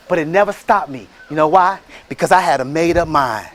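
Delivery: normal talking voice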